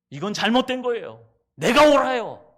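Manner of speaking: loud, high shout of defiance; defiant shout. Acted. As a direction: rebel against God at full voice. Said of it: "Jonah defying God". (하나님께 대드는 요나, 큰 소리로)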